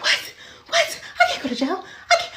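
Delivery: high-pitched panicked disbelief, acted out; freaking out. Student told she may face jail, freaking out in a high voice. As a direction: squeal it in dramatized alarm. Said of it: "I'm freaking out".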